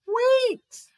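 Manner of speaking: high, loud sudden cry; loud exclamation. Acted. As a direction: burst out Wait.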